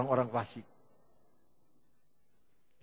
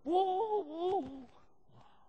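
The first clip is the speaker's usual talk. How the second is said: crowd yelling; much slower than usual. Retold or imitated. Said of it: "orang teriak-teriak".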